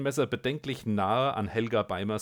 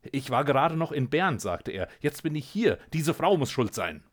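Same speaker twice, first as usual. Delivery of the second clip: high, loud, rattled accusation; agitated; 6 semitones higher, 6 dB louder than usual. Acted. The scientist blames Helga to the cat, high and agitated. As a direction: blurt it out in a fluster.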